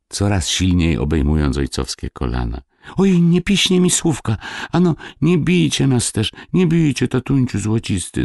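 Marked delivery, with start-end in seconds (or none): 3.0-8.2 put-on very high, whimpering child's plea; tearful pleading. A narrator's reading. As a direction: beg father for mercy in a boy's high wail. Sobbing boys beg not beaten. (płaczliwe błaganie wysokim głosem)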